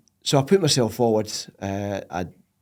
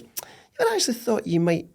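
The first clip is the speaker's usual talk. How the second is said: imitating his wife; 6 semitones higher than usual. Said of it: "She says".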